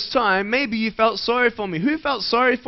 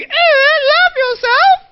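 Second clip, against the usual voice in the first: high, drawn-out incredulous squawk; grossed-out kid; 9 dB louder, much slower than usual. Retold or imitated. Speaker mimicking kids told to love themselves, high mock disgust. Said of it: "you're probably thinking".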